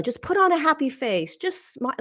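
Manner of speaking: high, sing-song parody of toxic positivity; mock sugary advice. Ridiculing people who say smile through grief, high and sugary. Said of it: "Like, you know".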